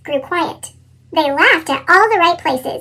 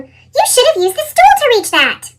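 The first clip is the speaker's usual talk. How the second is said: scolding mom; 8 semitones higher, 3 dB louder than usual. A narrator's reading. Mom scolding after spill.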